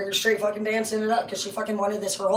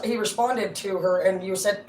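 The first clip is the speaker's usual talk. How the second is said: ordinary talk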